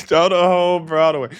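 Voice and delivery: loud, laughing exclamation; laughing outburst. Real, not acted. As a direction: blurt it out loudly through laughter. Laughing reaction to the clip.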